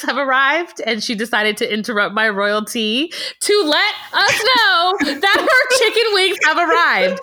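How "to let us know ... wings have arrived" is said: mocking. Mocking Amanda's chicken wing update.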